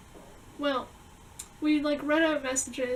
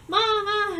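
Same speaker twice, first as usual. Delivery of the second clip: panicked call; 5 semitones higher, 6 dB louder than usual. Retold or imitated.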